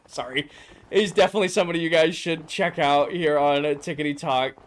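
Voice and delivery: normal talking voice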